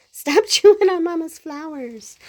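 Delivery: loud, high, laughing scold; playful scolding. Real, not acted. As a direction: scold the pet through a laugh.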